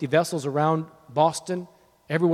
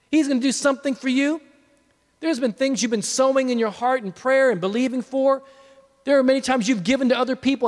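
raised, insistent preaching voice; fervent preaching; 7 semitones higher than usual. Real, not acted. Preacher promising God's help within seventy-two hours, fervent preaching. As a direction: press the promise home.

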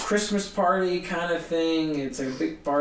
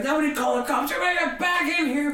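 urgent calling out; 9 semitones higher, faster than usual. Retold or imitated. Booker ordering crowd back, avoiding police.

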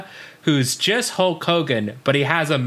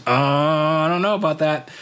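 Hulk Hogan; 3 semitones higher than usual. Retold or imitated. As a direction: stall with a long, doubtful wrestler "uh". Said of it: "He goes".

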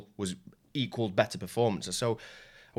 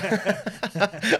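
laugh; 11 semitones higher, 7 dB louder than usual. Retold or imitated. Opponent laughing, still imitated.

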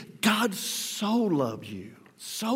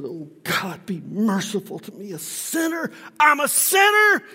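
pleading publican; 4 semitones higher, 4 dB louder than usual. Retold or imitated. Repentant publican crying out to God, building from plea to shout. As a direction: beg for mercy, rising to an anguished cry. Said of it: "beats his chest and he says".

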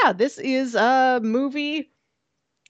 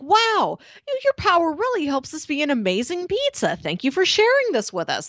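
lightly voiced gushing pizza customer, high and quick; delighted customer; 7 semitones higher than usual. Customer thanking the fire-powered pizza maker, mock delighted. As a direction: gush over the fire-baked pizza. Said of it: "people will be like".

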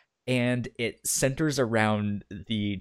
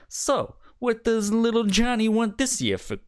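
shady mobster making an offer; mafia gangster; 11 semitones higher than usual. Retold or imitated. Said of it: "you're like".

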